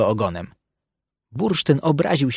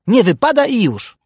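much higher, louder indignant ruling; 10 semitones higher, 5 dB louder than usual. A narrator's reading. Puc tells Bursztyn off for asking why, indignant. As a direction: shut down the question indignantly. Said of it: "obruszył się Puc".

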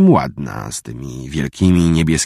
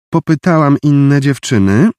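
less sweet, sharper; 10 semitones higher, 6 dB louder than usual. A narrator's reading. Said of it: "dodała już nie tak słodko".

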